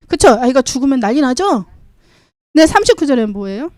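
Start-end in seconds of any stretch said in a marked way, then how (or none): none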